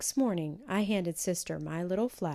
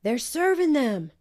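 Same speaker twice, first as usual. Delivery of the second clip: higher, louder joyful shout; excited shout from the street; 7 semitones higher, 9 dB louder than usual. A narrator's reading. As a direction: call out the good news. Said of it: "someone shout".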